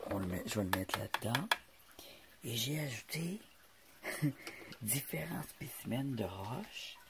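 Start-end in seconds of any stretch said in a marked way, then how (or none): 2.4-7.0 soft whispered aside; whispering. Real, not acted. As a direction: hush it, half under breath. Whispered aside while filming.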